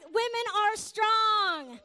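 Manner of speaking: loud, high, drawn-out proclamation; acted emotional shout; emphatic tribute. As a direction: declare it slowly at full volume. Acts out husband's loud tribute.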